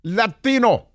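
mock Spanish accent. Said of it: "thick accent, Spanish accent".

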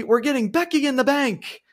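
very high, loud put-on commentator voice; mocking the commentator's line, very high and loud; Kevin Patrick impression. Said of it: "I can't do his accent".

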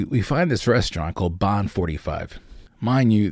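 ordinary talk

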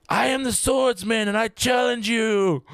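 high, theatrical fantasy hero voice; nerdy swordsman voice. Pretend. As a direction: ham up a D and D swordsman challenge. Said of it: "like, a bunch of nerds playing D and D".

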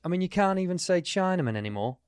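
imitated Englishman, chatty British accent. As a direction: put on a British driver's accent. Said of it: "the accent you just did".

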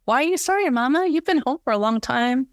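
high, sweet child voice voicing her kids; kids' voice. Kids reassure their mama about her job, high child voice. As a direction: play the kids cheering mom on, lightly high. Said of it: "And they're like".

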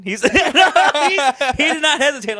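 words squeezed out through high laughter; laughing. Real, not acted. Teasing his friend who kept eating, laughing hard.

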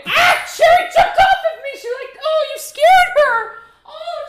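laugh-cry as the cat bolts; high laughing squeal. Real, not acted.

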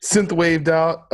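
high, slow, playful drawn-out synth. Real, not acted. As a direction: stretch synth waved out, goofing.

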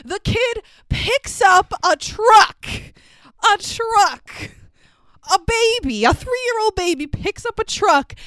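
stunned outburst; incredulous. Real, not acted. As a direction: hammer home how absurd it is. Stunned retelling of the absurd scene.

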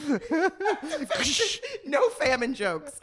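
slow, high, laughing protest; crying with laughter. Real, not acted. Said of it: "laughing cry".

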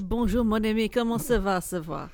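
mock French bonjour; playful French greeting. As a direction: greet him like a Parisian.

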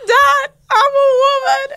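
high, loud, slow teary wail; mock wailing cry. Teen wailing to her confused dad, mock crying. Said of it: "I'm, like, crying".

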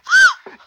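sharp high frightened gasp; startled gasp. Acted. Girl startled by a man at her window, sharp gasp.

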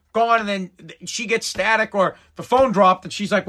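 ordinary talk

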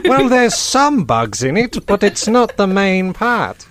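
high and slow, sheepish backpedal; defensive Brit. Retold or imitated. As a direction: explain it away, prim and slow. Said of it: "how British people get offended".

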